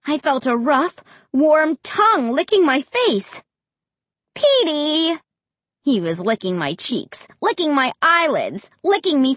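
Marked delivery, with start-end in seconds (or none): 4.3-5.2 high, drawn-out name; delighted cry. A narrator's reading. Girl surprised by her dog licking her, delighted. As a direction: exclaim the dog's name happily.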